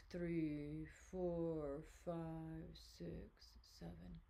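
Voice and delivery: soft counting, fading toward the end; guided breathing count. Acted. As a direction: count the breath out slowly, letting the voice drop.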